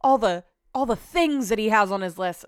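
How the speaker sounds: ordinary talk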